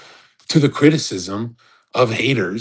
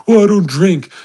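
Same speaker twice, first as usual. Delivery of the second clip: higher and louder, prissy mock reply; mock quote; 7 semitones higher, 6 dB louder than usual. Imitating a sober-claiming influencer to the audience, mocking and raised. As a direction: sneer it like a fake excuse.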